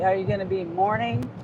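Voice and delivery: ordinary talk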